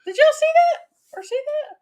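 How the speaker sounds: high, sudden surprised question; startled question. Real, not acted. Wife startled by something seen on camera, surprised. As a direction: blurt it out startled.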